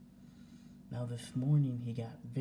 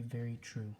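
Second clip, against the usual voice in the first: ordinary talk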